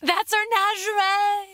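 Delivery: high, slow drawn-out call; mock shout. Imagining shouting the product name at a girl, high mock holler. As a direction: playfully holler it, stretching the words. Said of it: "Imagine if you shouted that at her".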